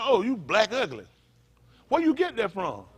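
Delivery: high, exclaiming imitation of an insult; mock taunting. Voicing people parroting learned insults.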